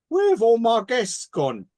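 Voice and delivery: high, slow, failing Michael Caine Cockney; Cockney Michael Caine, hammed up and slow; Michael Caine impression. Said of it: "lost the ability to do a Michael Caine accent".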